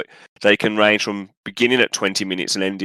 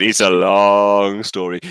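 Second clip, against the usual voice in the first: weary slow dodge; 3 semitones lower, 3 dB louder, much slower than usual. Retold or imitated. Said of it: "I said".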